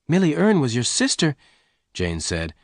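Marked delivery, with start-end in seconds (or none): 0.1-1.4 high, rising disbelief; astonished question. Acted. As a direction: repeat it back as a startled question. Jane astonished by the revelation.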